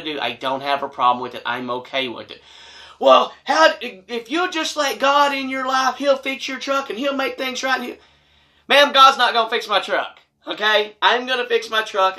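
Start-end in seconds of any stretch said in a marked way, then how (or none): 3.0-8.0 high, mocking nasty woman voice; high nagging church lady impression; mocking the hateful mom. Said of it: "Hateful, rude, nasty". 8.7-12.2 exasperated. Retold or imitated. Arguing back at the mom.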